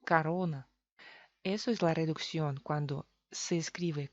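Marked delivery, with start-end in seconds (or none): 0.0-0.7 corona said as ka-RO-na; first o of corona relaxed into an a; Russian-style corona, unstressed o turned to a; Russian vowel reduction. Shown as a demonstration. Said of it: "los rusos la pronuncian así".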